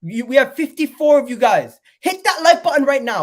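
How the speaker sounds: excited. Real, not acted. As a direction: push the viewers to hit like. Urging viewers to like.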